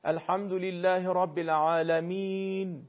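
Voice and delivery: slow recitation with a drawn-out "ee" in "العالمین"; long ya as "ee". Shown as a demonstration. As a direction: stretch the last vowel of "العالمین" as a clear ee. («العالمین» با یاء کشیده به صورت ای)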